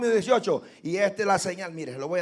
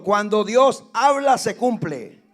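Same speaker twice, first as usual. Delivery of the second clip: "cuando Dios habla" much louder, preaching force; louder repeat; 3 semitones higher, 12 dB louder, slower than usual. Shown as a demonstration. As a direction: repeat it louder, driving it home. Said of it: "Lo repito". (repetición más fuerte, con fuerza de predicador)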